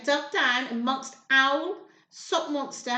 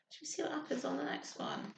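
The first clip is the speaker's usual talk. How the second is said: whisper; 12 dB quieter, faster than usual. Real, not acted. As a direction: lean in and whisper the question. Whispered aside to child.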